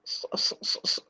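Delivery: "s-" breathed out, voiceless; voiceless "s-" repeated as a drill; stuttered s sounds. Shown as a demonstration. Teacher drills the sad sound for students. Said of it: "See how heavy they are?".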